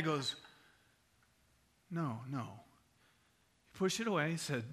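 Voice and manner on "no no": low, soft, firm no; acted subdued rejection; quiet refusal. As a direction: turn it down gravely. Acted customer refusing the gift.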